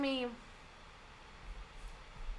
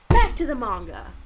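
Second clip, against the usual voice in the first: loud announcement; 3 semitones higher, 15 dB louder than usual. Real, not acted. Announcing return to reading again.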